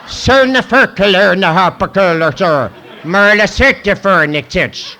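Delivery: loud, slow fake Swedish gibberish; mock Swedish. [name] delivers his line, loud slow mock Swedish gibberish. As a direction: spout mock Swedish nonsense. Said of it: "[name] talks Swedish".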